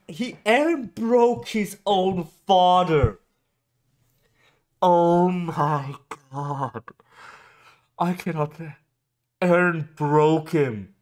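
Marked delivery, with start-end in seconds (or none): none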